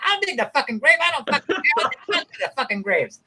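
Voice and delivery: high-pitched irritated retort, imitating a movie gangster; mock angry. Gangster refusing help with the grave, mock angry. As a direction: play the hot-tempered mobster snapping back. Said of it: "He was so angry".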